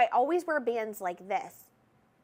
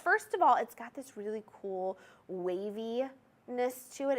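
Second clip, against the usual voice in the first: ordinary delivery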